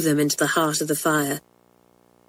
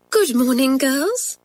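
higher-pitched teacher greeting her class; teacher's greeting; 7 semitones higher, slower than usual. A narrator's reading. Nervous teacher sits down and greets her class, higher-pitched greeting. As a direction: greet the girls as their schoolmistress. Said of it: "said Miss Kennedy".

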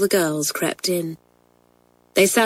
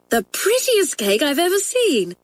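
put-on higher girlish voice; admiring schoolgirl; 10 semitones higher than usual. A narrator's reading. Hilary praising Kathleen's cake in a higher girlish voice. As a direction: coo over the pretty cake. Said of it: "said Hilary".

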